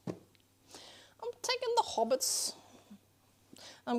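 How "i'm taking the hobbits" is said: slow, secretive whisper; sneaky whisper. Pretend. Streamer whispering to chat while sneaking the hobbits, slow sneaky whisper. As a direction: whisper it like a playful thief.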